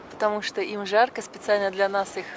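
usual speaking voice

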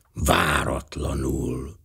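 slow whispered mutter; muttered under his breath. A narrator's reading. Poirot rereads the death notice, whispering to himself. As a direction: breathe it to yourself, slowly. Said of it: "morogta a bajsza alatt".

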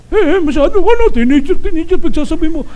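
put-on high, giggly old-man reply; coy, tickled grandpa voice; flattered grandfather. Grandfather answering the salesperson's flattery, coy and high.

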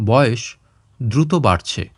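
ordinary delivery

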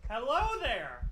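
loud, slow drawn-out greeting; calling out. Pretend. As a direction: hail the ghost, stretching it out. Calling out to the ghost.

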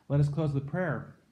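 ordinary talk, nothing marked

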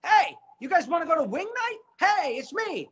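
high, pushy office jerk inviting everyone out. Acted. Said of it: "Pat Finelli is my office jerk".

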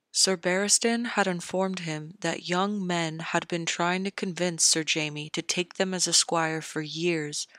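usual speaking voice

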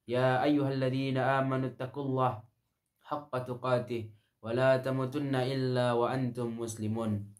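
usual speaking voice

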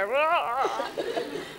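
drawn-out throaty comic roar. Shown as a demonstration. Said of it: "I just go".

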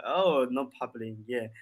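ordinary talk, nothing marked

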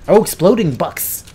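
normal talking voice

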